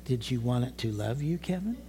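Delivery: the Lord gently teasing a troubled preacher, softly; sweet gentle voice. Retold or imitated. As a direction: let the question land softly, like a loving father. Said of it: "the sweet voice of the Lord".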